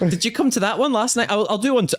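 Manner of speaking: high-pitched mock challenge; defensive. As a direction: act needled, scrambling to justify yourself. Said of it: "Get real defensive".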